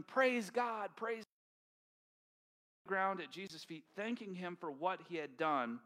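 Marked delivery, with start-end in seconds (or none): 0.0-1.3 high, loud cry of praise; healed man running back, shouting praise. Retold or imitated. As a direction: shout your thanks to God. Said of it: "runs back to Jesus shouting".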